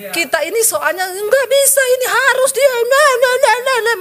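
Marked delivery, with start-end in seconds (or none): 1.0-2.7 mock insistence. Mocking stubborn insistence. 2.7-4.0 taunting. Pretend. Teasing devil's taunt.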